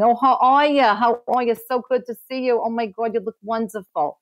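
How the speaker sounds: high, bright-voiced imitation of a Brooklyn-born mom with her Jersey accent; mom's Jersey accent. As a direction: gush a Jersey-accented greeting the way mom did. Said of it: "adorable Jersey accent".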